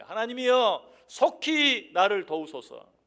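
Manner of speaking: loud, high, slow plea to God; urgent prayer cry. Acted. David calling on God for help, loud urgent cry. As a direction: cry out with drawn-out, forceful words. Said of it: "오히려 하나님을 향하여".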